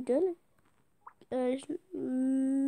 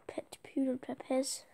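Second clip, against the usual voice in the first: ordinary talk, nothing marked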